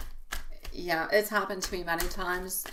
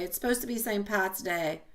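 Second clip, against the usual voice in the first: normal talking voice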